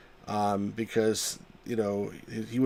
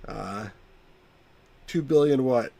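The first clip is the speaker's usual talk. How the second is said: usual speaking voice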